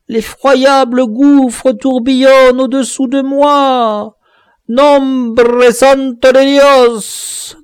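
high, loud, slow desperate begging; terrified dwarf begging for mercy, high and loud; pleading in terror. Acted. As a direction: beg for your life from the edge. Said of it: "suppliante et douloureuse".

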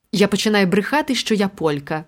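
ordinary delivery

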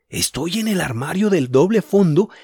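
thinking it through with excitement; Julián thinking. Acted. Julián's thoughts voiced.

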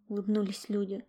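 ordinary delivery

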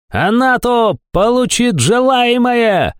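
very high, drawn-out villainous screech; shrill cry. A narrator's reading. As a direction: shriek it slowly in a piercing put-on voice. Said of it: "визгливо закричал кто-то издалека". (визгливый протяжный крик)

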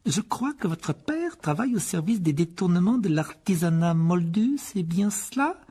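aigu, doucereux, fin chuchotée; sourire féroce. A narrator's reading. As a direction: menacer en souriant. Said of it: "un sourire plus féroce que jamais". (ton cruel et souriant)

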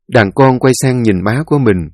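ordinary delivery

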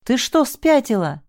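high, drawn-out yell of disbelief. A narrator's reading. Man yells at her for running into danger. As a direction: yell at her, slowing the words. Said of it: "заорал Саша".

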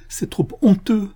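high, breathless whispered shame; ashamed whisper to Francis. A narrator's reading. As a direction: hide the words in a whisper. Ashamed girl confesses jealousy.

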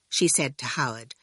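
usual speaking voice

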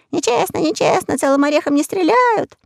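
high squeaky chorus of flies; buzzing flies' protest. Acted. Flies complaining to the parrot, high and squeaky. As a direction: squeal it like a tiny fly.

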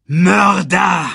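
loud, high furious cry; young lion yelling at his father's killer; shout. A narrator's reading. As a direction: hurl the accusation like a roar. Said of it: "schrie Simba".

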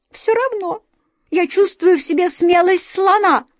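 very high, squeaky and brave; squeaky mouse. A narrator's reading. A grey mouse boasting to the others, high brave squeak. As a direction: squeak it out boldly like a tiny hero. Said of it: "пискнула серая мышь".

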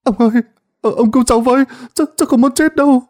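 high, fast, panicked pleading; tearful begging. Acted. Terrified boy begging the sorcerer to save him, high and frantic. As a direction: beg for your life in a rush. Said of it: "nó khóc lóc van xin".

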